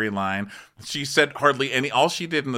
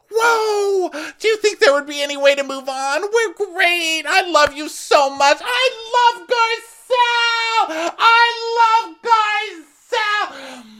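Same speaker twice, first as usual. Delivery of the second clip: squealing, very high manic cheer; Rinna as cheerleader; 5 dB louder, slower than usual. Retold or imitated. Rinna suddenly loving Garcelle, cheerleader squeal. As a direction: shriek it like a cheerleader, stretching the last lines. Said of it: "Rinna becomes her cheerleader self".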